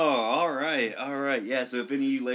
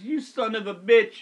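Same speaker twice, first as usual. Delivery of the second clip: higher, louder mock-angry retort; outraged lifeguard; 8 semitones higher, 7 dB louder than usual. Pranked lifeguard realises who called, imitated loud and outraged. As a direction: curse him as the duped victim, pitched up. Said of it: "he looks at me and he goes".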